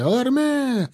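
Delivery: high, drawn-out alarm cry; frantic cries. A narrator's reading. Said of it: "раздались на ней истошные крики".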